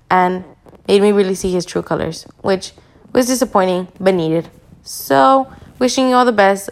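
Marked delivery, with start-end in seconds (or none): none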